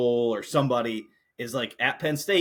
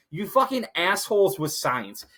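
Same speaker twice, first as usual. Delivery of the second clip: ordinary delivery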